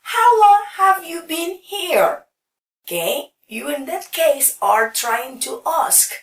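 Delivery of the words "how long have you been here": a higher, louder asked question; acted example question, higher and louder. Shown as a demonstration. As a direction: ask it as if to a guest. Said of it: "Other example".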